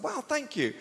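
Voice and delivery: high-pitched gushing gratitude; retold delighted thanks; flattered thanks. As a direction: gush it high, taken aback. Flattered comic thanking his admirers.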